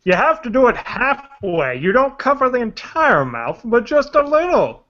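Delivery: swooping singsong masked villain; exaggerated rise and fall on every phrase; Bane voice. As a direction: lecture in a hammed-up villain imitation. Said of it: "he tried to do a Bane voice".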